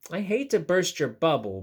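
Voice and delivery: usual speaking voice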